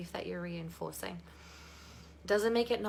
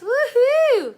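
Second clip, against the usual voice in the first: loud, very high woohoo; big celebratory holler; excited whoop; 16 dB louder than usual. Real, not acted. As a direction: whoop it up for the group. Cheers the group on.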